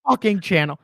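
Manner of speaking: loud mock YouTube welcome; shouted intro. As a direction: belt out the channel welcome.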